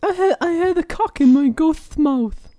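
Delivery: very high squeaky voice with a lisp; high lisping ghost voice. Acted. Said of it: "You sound kinda like what's-her-face".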